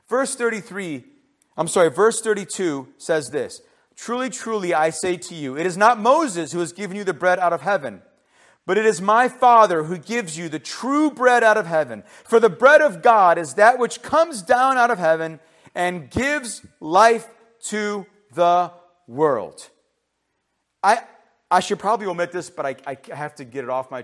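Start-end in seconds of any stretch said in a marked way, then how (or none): 3.9-16.1 loud, building pulpit reading; emphatic scripture reading. Real, not acted. Preacher reads Jesus' words to congregation, emphatic scripture reading. 16.1-19.7 "gives life to the world" very slow and loud; emphatic scripture reading. Real, not acted. Preacher reads the key verse, "gives life to the world" slowly hammered out. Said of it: "Why am I emphasizing that?".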